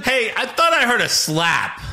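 high-pitched mock referee; indignant referee. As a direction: accuse like a ref who overheard. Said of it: "then the ref goes".

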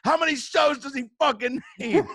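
high, loud comic outrage with laughter; livid. Retold or imitated. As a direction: retell your fury, voice shooting up. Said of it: "I was livid. I was like".

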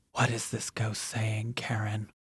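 spooky whisper. Pretend. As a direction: breathe it out like a ghost story. Spooky question after ghost clip.

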